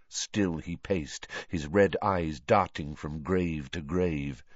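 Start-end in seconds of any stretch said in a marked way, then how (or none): none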